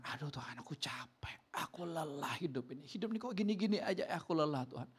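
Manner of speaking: soft, exhausted plea to God; weary surrender. Acted. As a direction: sigh it out quietly, worn out. (keluhan lelah, lirih)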